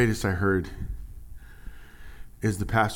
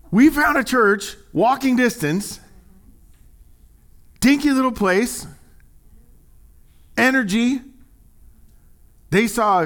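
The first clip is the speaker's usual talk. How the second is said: excited; 12 semitones higher, 6 dB louder than usual. Real, not acted. Pastor telling the congregation about a lively church, excited. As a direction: savour each word with delight.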